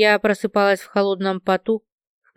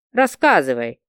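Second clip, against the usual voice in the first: angry; 7 semitones lower than usual. Acted. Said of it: "разозлилась я".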